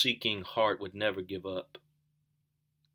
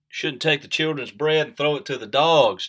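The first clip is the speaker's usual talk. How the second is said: loud, higher stern voice of Jesus; Jesus rebuking; 6 semitones higher, 9 dB louder than usual. Retold or imitated. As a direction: boom out the rebuke, higher and louder. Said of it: "Jesus said".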